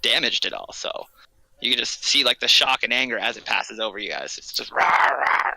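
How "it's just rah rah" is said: slow, whispered mock dragon roar; mock beast growl, whispered; whispered dragon rah. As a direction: breathe out the dragon's angry rah, slowly. Dragon passing over the party.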